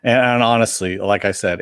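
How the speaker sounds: ordinary delivery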